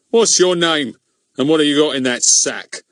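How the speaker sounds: high, loud official questioning; bossy demand. Acted. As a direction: grill him like a pompous constable. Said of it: "he began".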